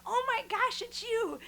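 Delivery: high, delighted burst of recognition; excited greeting. Retold or imitated. Passenger spots her favorite shuttle driver, excited. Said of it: "I was just excited to see her".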